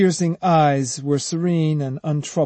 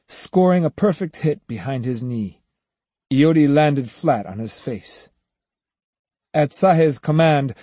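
ordinary delivery, nothing marked

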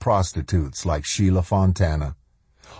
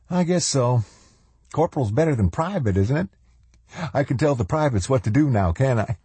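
high, needling upstart; cheeky new corporal in a high-pitched voice; cocky intruder; 7 semitones higher, faster than usual. Acted. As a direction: answer high and quick, pleased with yourself. Mangrum accepts the rank.

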